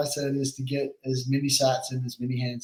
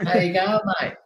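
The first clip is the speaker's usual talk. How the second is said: Aussie greeting. Retold or imitated. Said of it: "it's an Australian colloquialism".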